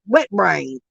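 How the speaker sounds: ordinary delivery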